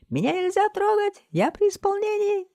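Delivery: very high, loud squeaky protest; mouse squeak. A narrator's reading. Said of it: "пискнул он". (громкий высокий мышиный писк)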